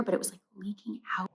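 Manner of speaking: quick hushed whisper. Real, not acted. Describing the leaking carrier in a quick whisper. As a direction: whisper the gross part fast.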